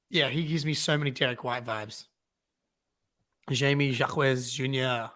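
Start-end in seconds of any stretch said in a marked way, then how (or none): none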